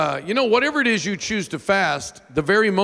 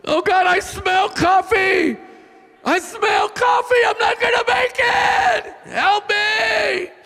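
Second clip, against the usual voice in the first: whiny prayer. Retold or imitated. Preacher craving coffee at the prayer chair, high whiny wail. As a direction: beg God like a craving child. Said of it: "I'm a whiny baby at prayer".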